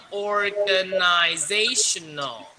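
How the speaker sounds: organizational said slowly and carefully; slow model reading. Shown as a demonstration. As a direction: demonstrate slowly for the student. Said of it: "我跟你说". (慢慢示范念)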